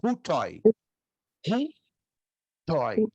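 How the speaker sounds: "hutoi" as HOO-toy, breathy h first; aspirated "hutoi" ending in an open OY; teaching pronunciation. Shown as a demonstration. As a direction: model "hutoi" slowly, syllables pulled apart. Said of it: "Aí eu pronuncio".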